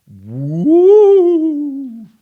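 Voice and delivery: long loud high wail; ghostly woo. Acted. Reading to kids, wailing like the ghost.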